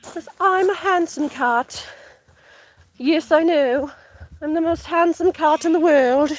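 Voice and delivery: slow, slightly British dignified cat; Stratus, dignified man. Acted. Owner voices the handsome cat, dignified and a little bit British. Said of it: "He's just a little bit British".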